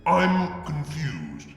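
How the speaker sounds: ordinary delivery, nothing marked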